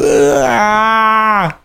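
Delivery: high imitated cry of pain; mock crying. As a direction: whimper it like a man who hurt his foot. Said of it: "he just goes".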